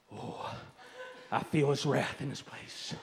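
hushed tail of a preacher's parody sermon; mock evangelist awe. Shown as a demonstration. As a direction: drop from shouting into hammed-up awe of divine wrath. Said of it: "you have to do something like this".

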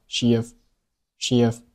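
she of run together slowly as one unit; linked she of. Shown as a demonstration. Teacher demonstrating linking of she and of. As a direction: glide she straight into of. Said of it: "连读成了".

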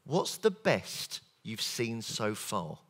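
slow, soft model question. Shown as a demonstration. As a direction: lay out the right question slowly. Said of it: "The right one".

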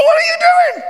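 high, loud indignant yell; alarmed shout on the bus; shocked shout. Retold or imitated. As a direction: shout it in outrage. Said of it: "She go".

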